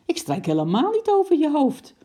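high, loud protest; indignant denial. A narrator's reading. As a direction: deny it heatedly. Acted quarrel, husband denies it.

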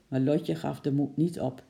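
plain reading voice

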